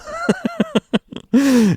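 kurzes, sehr hohes quiekendes Lachen; hohes Auflachen. Real, not acted. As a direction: quiek vor Lachen kurz hoch. Witz über alkoholfreies Bier. (hohes quiekendes Lachen)

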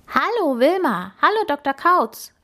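put-on lively piglet girl, pitched well up; wild boar girl calling. A narrator's reading. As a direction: call out a cheerful greeting, high. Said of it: "rief sie".

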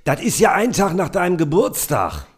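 very high, gushing mother voice; shrill-free but sky-high chatty mum showing off; proud mother on the phone. Acted. Podcaster reads the mother's lines bragging to Waltraud, in a very high put-on voice. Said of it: "Du bist Mutter".

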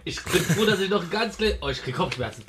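pained, burning throat; winded by the spicy shot; mouth burning from spicy food. Real, not acted. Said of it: "mir tut die Brust gerade weh".

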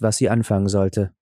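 usual speaking voice

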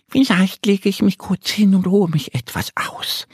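high, bright little duck Annie. Acted. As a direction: play the small duck, pitched well up. Müde Ente überlegt laut. (Annie weckt Mo mit hoher Entenstimme)

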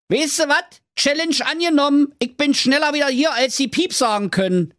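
high, cheeky Berlin voice; cocky Berlin hero. Acted. Schnitzelschorsch accepts the king's challenge, high cheeky Berlin voice. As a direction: toss off the challenge with swagger.

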